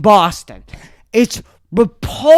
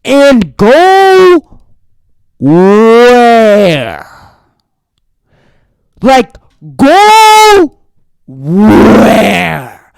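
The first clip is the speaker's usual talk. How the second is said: loud, drawn-out yell of disbelief; outraged shout; 9 dB louder, much slower than usual. Real, not acted. Vlogger mocking the news advice, a loud stretched outraged yell. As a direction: hurl it out, stretching each word.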